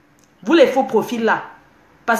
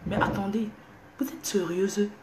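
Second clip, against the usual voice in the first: hushed whisper, much softer; 10 dB quieter than usual. Real, not acted. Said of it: "je parle doucement". (chuchoté, à voix basse)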